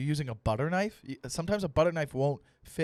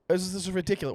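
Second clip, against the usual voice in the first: ordinary talk, nothing marked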